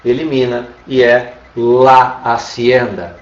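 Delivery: slow demo. Shown as a demonstration. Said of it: "então aí a gente já".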